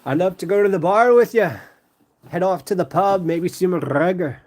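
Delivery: amateur Irish lilt, drifting toward Scottish; attempted Irish accent. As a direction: playfully fake an Irish accent. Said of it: "I don't know how to talk Irish".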